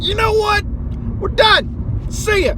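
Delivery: mock defiant exit; hammed-up fed-up American; high, loud huffy quitter. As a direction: squeak it out and storm off.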